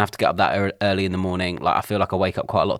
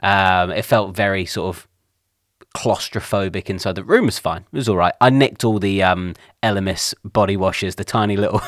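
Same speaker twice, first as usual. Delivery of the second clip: ordinary talk, nothing marked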